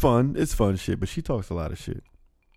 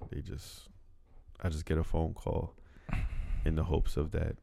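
ordinary talk, nothing marked

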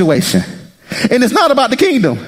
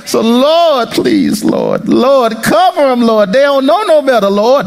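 interceding prayer; 4 semitones higher, slower than usual. Acted. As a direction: plead with the Lord to cover them.

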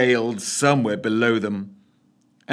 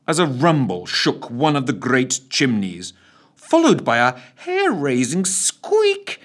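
ordinary delivery

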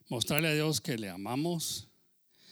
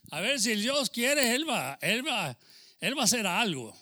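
loud, slow fervent preaching; fervent pulpit declaration; preaching build; 6 semitones higher, 6 dB louder than usual. Real, not acted. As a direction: proclaim it with rising conviction. Preacher rises in fervor.

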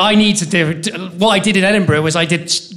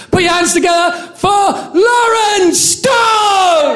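high, stretched-out hype call; announcer shout; 12 semitones higher, much slower than usual. Real, not acted. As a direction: bring the act on with a big drawn-out yell. Host introducing next act.

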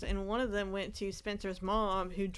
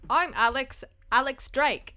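higher, louder mock suave Bond intro; James Bond style intro; 4 semitones higher, 7 dB louder, slower than usual. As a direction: introduce yourself like a spy. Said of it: "Like she's James Bond".

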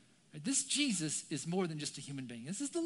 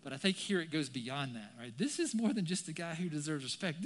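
ordinary talk, nothing marked

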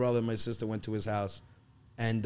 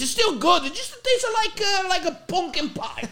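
much higher and louder, an insistent dad; 8 dB louder than usual. Retold or imitated. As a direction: sell the cinnamon mistake as still good. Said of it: "He goes, he goes".

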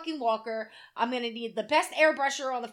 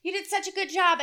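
imitated high-pitched, effusive praise; gushing waitress; 7 semitones higher, 3 dB louder than usual. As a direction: gush like an impressed waitress, pitched up. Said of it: "waitress comes up to me and she says".

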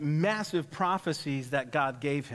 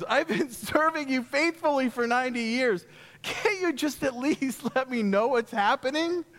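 higher, exasperated plea to God with laughs; frustrated pleading; 8 semitones higher, 3 dB louder than usual. Retold or imitated. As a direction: beg for just a little answer, fed up. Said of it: "Wouldn't you be frustrated?".

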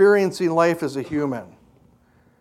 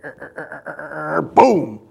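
creaking tree then boom; 2 semitones lower than usual. Retold or imitated. Telling the congregation about the tree, creak and boom. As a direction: imitate the tree groaning and crashing down.

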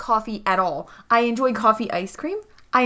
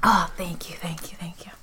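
grateful whisper; 5 semitones lower, 5 dB quieter than usual. Acted. Grateful groggy patient.

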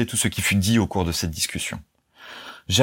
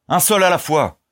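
full-force high roar; shouted; 9 semitones higher, 4 dB louder than usual. A narrator's reading. Ernest silencing the shouting crowd, full-force shout. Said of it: "cria-t-il de toutes ses forces".

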